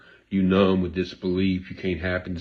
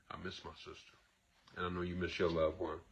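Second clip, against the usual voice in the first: much softer, choked-up admission; real grief; 13 dB quieter, faster than usual. Real, not acted. Host confiding to viewers about his late sister, real quiet grief. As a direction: let the loss show as you say it. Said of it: "I paused, I had a moment".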